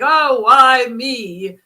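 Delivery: milk the why me like a drama queen; dramatic self-pity. Retold or imitated. Woman mocking her own self-pity after surgeries, hammed-up why me. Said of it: "I'm very dramatic".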